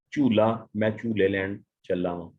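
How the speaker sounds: ordinary delivery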